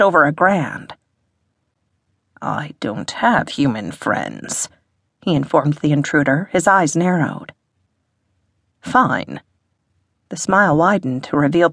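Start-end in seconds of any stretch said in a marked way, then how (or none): 2.4-4.7 low, slow menacing voice; cold hostile rebuff. A narrator's reading. Hostile Hiss rebuffs the intruder. 8.8-9.4 lower-pitched posh English voice; posh English accent. A narrator's reading. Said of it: "in a posh English accent".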